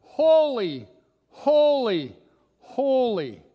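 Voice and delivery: loud, high ringing cry of worship; calling out. A narrator's reading. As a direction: call each word out, then let it fall. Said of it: "they were calling one to another".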